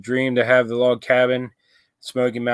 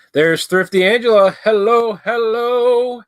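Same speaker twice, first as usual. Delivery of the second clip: excited greeting; 10 semitones higher, 3 dB louder than usual. Real, not acted. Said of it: "You sound like an auctioneer".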